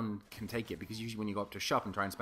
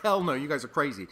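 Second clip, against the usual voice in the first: emphatic refusal; 4 semitones higher, 7 dB louder than usual. Retold or imitated. Refusing the friends' dare.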